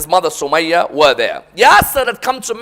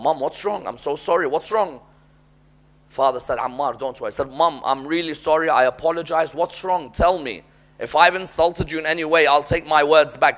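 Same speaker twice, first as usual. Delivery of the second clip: soft, lower, tender pleading; gentle apology; 5 semitones lower, 8 dB quieter than usual. Retold or imitated. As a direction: plead softly with his crying mother. Son apologizing to crying mother.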